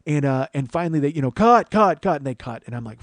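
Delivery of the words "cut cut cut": "Cut" called out high and loud, falling each time; calling out cut. Retold or imitated. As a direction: shout "Cut, cut, cut" like a director on set. Director calling cut on set.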